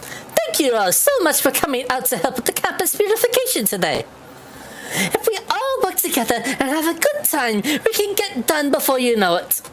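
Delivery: high, chirpy cheerleader voice; bubbly and overenthusiastic; overly happy girl. Acted. Voicing overly happy Rosa.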